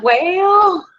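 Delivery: a high, drawn-out sassy "Well". Retold or imitated. Answering her grandma with a high, sassy "Well". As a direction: answer grandma with mock innocence.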